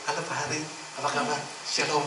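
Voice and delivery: soft, syrupy church greeting; mock sweet greeting. Pastor mocking the elder's soft, sweet public greeting. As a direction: fake politeness. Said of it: "Di belakang sama T U kayak begini".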